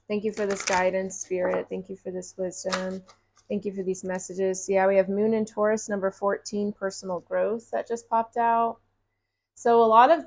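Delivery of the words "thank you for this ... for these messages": quiet, reverent thanks to spirit; gentle gratitude; soft thanks prayer. Acted. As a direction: murmur your thanks to spirit.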